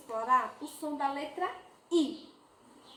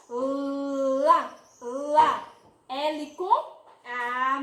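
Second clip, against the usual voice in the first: L com A spelled out slowly; slow sounding out; 2 semitones lower, 3 dB louder, much slower than usual. Shown as a demonstration. Teacher to class, slowly spelling out the syllable. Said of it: "teacher sounding out syllable".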